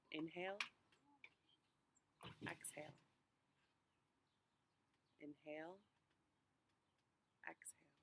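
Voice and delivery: unhurried meditation voice with long pauses; guided meditation. Real, not acted. Pastor walking congregation through a walk to Emmaus, guided meditation. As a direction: guide listeners gently, phrase by phrase, leaving space to breathe.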